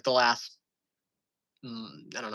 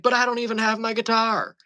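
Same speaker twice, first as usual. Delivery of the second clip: put-on drunk mom; 9 semitones higher, 4 dB louder than usual. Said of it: "And she's like".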